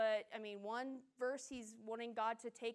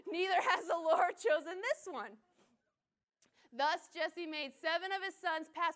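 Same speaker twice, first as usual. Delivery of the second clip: louder, higher redo of "Neither has the Lord chosen this one"; bold retry; 5 semitones higher, 8 dB louder than usual. Shown as a demonstration. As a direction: nail the line now, loud and proud. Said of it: "you know where I get my reading skills".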